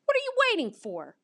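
high, fast, eager question; quick high-pitched impatience; impatient bark. A narrator's reading. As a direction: urge her on like an excited pup. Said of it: "I bark".

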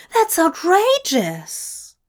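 high, drawn-out exclamation of outrage; outraged cry. A narrator's reading. Angel asked to flog his friend, outraged cry. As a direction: protest in scandalised horror, stretching the word. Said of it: "Zira cried".

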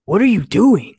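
high, loud demand; mock boss yell. Boss yelling at a late employee, loud and high. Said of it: "basically screaming at me".

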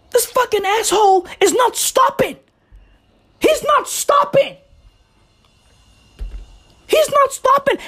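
high-pitched, fed-up outburst; exasperated complaint. Real, not acted. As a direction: rant helplessly at noise that won't stop. Ranting at noisy worker outside.

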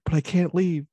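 high, loud desperate cry; tearful plea. Retold or imitated. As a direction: beg her not to go, loudly. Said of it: "she's like".

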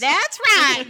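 loud, high playful showman's cheer; put-on showman. Teacher egging on the riverboat bit, loud showman voice. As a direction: sing out agreement in a showman's accent.

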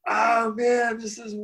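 high, drawn-out uneasy groan; freaked-out dismay. Retold or imitated. Hippie being hugged by a huge stranger, high freaked-out dismay. Retells panic at stranger's hug.

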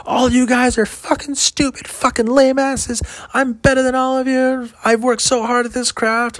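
very high, slow, squealed ranting heckler; mock ranting heckler. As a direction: ham up her ranting meltdown. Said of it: "throws them at him".

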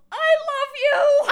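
high, loud, laughing yell; yelled love you. Retold or imitated. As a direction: shout it out over the noise. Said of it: "and she looks over at me and yells".

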